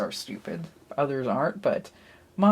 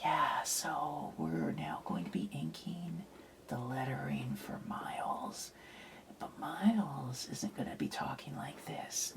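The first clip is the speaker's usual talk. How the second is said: unvoiced ASMR-style whisper; a playful mock ASMR whisper; 10 dB quieter than usual. Shown as a demonstration. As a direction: drop to a breathy hush as a joke. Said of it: "I would have to start talking like this".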